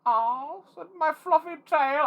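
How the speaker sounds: very high, silly cartoon rabbit voice; high-pitched, drawn-out mock rabbit; put-on Jax voice. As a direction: squeak it out like a toy rabbit putting on a character voice. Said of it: "I don't think he talks like that".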